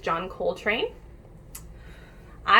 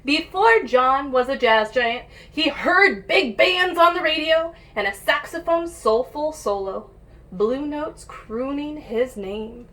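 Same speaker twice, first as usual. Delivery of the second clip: put-on lively read-aloud for kids; picture book reading; 3 semitones higher, 6 dB louder than usual. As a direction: read it like storytime for little ones. Reads picture book to children.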